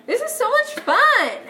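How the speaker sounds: high, loud forced delight; over-the-top fake glee; mock enthusiasm. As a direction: pretend to be having a great time, loudly. Claims fun, then admits annoyance.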